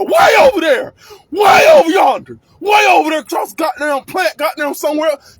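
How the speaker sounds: high, loud calling across the plant; mock distant yell. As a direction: holler as if from far away. Describing distant loud woman.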